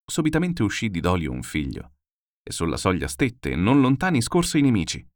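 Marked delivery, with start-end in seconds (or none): none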